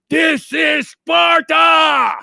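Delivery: high, drawn-out battle cry; Gerard Butler Sparta shout. Acted. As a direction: roar it slowly like a warrior king. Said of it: "that Gerard Butler thing going on".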